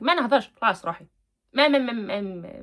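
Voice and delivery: loud, fast brush-off; mock refusal. Acting out Siham refusing to talk, brusque. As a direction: rattle off a loud acted refusal. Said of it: "إذا سهام قالت لك".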